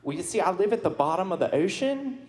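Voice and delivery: put-on folksy Southern accent, teasing the crowd. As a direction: play a good-ol'-boy Southern Aquaman. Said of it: "why do you have a southern accent?".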